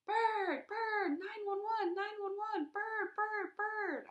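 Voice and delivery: high panicked screeching. Acted. Girl screeching with a bird on her head. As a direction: shriek it out in full panic. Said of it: "I screeched".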